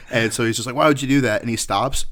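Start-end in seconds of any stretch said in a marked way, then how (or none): 0.8-1.4 higher, louder mock yell; retold yelling. Bouncer yelling at the man he threw out, mock angry. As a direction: imitate an outraged telling-off. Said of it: "yelling at him".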